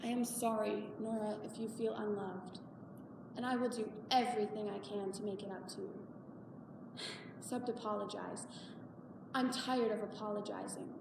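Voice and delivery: soft, low, subdued; weary apology. Acted. Mother apologizing to unhappy daughter, weary and soft. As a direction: say it quietly, worn out with apologizing.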